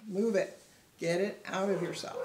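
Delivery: loud, forceful command; emphatic urging. Acted. Speaker urging someone crying to release it, emphatic urging. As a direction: push each short phrase out loudly.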